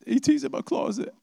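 put-on a little boy running to his parents; scared child.

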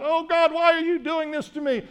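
high, pleading wail to God; the prayer he did not pray, a high desperate plea; mock despairing cry. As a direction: act out a panicked complaint, pitched well up. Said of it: "what's coming out of him is not".

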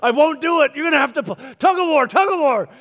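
a playground taunt at the door; taunting God. Retold or imitated. Preacher voicing a stubborn God, high and loud. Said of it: "and be like".

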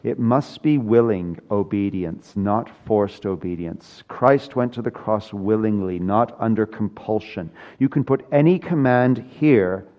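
ordinary delivery

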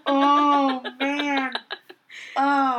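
high, loud laughing topic switch. Real, not acted. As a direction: laugh it off and change subject.

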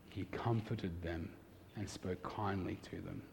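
hushed and tender, savouring "kindly"; tender repeat. Shown as a demonstration.

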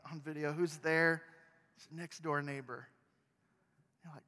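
usual speaking voice

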